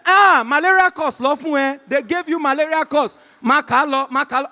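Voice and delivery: high, loud jubilant urging; celebrating at full volume; excited mother. Acted. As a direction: exclaim it like a proud, thrilled mum. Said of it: "she was so excited".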